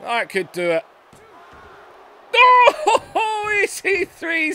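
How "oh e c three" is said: high, loud laugh breaking into the call; laughing shout. Real, not acted. Commentator amazed wrestler kicked out, laughing shout. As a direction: laugh in disbelief, then shout. Disbelieving kick-out.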